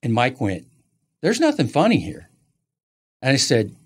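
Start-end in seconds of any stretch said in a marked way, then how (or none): 1.2-2.3 high-pitched retold scolding; mock reprimand, pitched up; stern rebuke. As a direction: imitate an official telling someone off. Official's rebuke, acted out.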